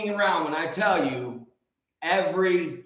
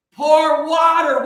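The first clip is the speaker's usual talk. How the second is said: slow, louder proclamation of pour water; hammed-up shout from the juicing steps; mock shout; 6 semitones higher, 9 dB louder, slower than usual. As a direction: boom it out like a grand reveal.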